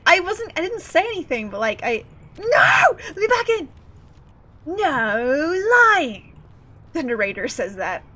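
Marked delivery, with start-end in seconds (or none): 0.1-3.7 panicked. Real, not acted. Panicking as character falls. 4.7-6.4 pompous posh English narrator; posh English accent. Retold or imitated. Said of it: "in his English-ass posh voice".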